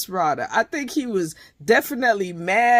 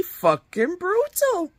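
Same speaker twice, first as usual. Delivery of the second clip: brutal jumps way up high and slow; shocked high squeal; 11 semitones higher, slower than usual. Real, not acted. Telling viewers how harsh the breakup scene was, high shocked squeal. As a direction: squeal it out in disbelief.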